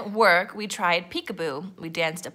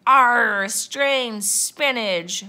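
loud, slow pirate wail; 3 semitones higher, 6 dB louder, much slower than usual. A narrator's reading. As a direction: wail it out as a dismayed crew. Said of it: "wailed the pirates".

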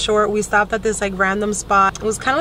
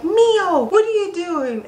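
calling out to the dog; 9 semitones higher, slower than usual. Retold or imitated. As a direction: call the puppy's name up high. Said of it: "we're like".

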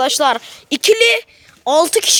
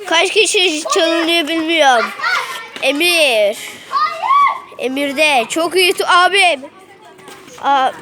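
quick live sports call; play-by-play commentary; slower than usual. Acted. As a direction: call the match as it happens. Live commentary of kids' match.

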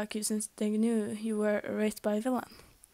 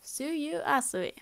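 mild cheer, higher and drawn out; 4 semitones higher, slower than usual. A narrator's reading. Frog-like girl introducing herself to the group, cheering. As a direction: introduce yourself with a slow, lilting cheer. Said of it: "The frog-like girl cheered".

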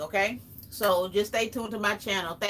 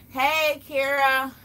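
calling out hello; 6 semitones higher, 5 dB louder, much slower than usual. Real, not acted. Greeting a viewer in chat.

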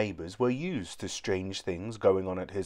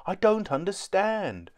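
groaning; 9 semitones higher than usual. A narrator's reading. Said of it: "groaned Doohickey".

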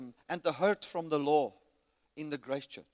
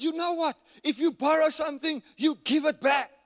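loud, higher indignant rule; angry complaint; 8 semitones higher, 5 dB louder than usual. Retold or imitated. Ranting to his wife about the borrowed chainsaw. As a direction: lay down the rule hotly. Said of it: "I said".

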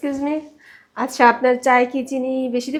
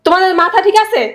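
loud, high, angry disbelief; incredulous outburst; 7 semitones higher, 6 dB louder than usual. Acted. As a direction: shout as if they've lost their minds. (অবিশ্বাসে রেগে চেঁচানো)

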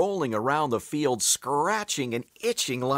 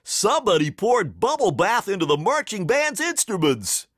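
high-pitched outraged fan; theatrical, higher-pitched holler; shouted announcement; 7 semitones higher than usual. A narrator's reading. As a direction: call out the prank from the bleachers. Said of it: "shouted a fan from the bleachers".

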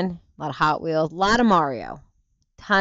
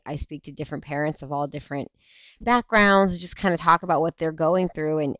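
normal talking voice

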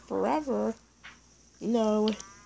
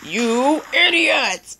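slow, louder crying yell; anguished yell; 5 semitones higher, 7 dB louder, much slower than usual. Real, not acted. Attacked by Mafusa again, anguished yell. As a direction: wail it out in frustration.

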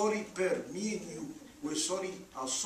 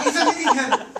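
laughing, louder, hell stressed; laughing exasperation; 5 semitones higher, 9 dB louder, faster than usual. Acted. Landlord laughing about parking hell, amused exasperation. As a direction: laugh it out in disgust.

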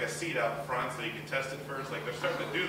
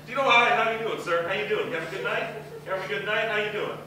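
higher-pitched, mock-friendly policeman; overly nice cop; 4 semitones higher, 4 dB louder than usual. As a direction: lightly play an over-polite officer greeting a driver. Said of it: "he was so nice about it".

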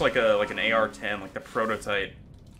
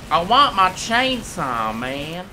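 slow, higher, louder whine; whiny drawl; 8 semitones higher, 8 dB louder, much slower than usual. Pretend. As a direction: stretch it like a sulking child. Drawn-out whine.

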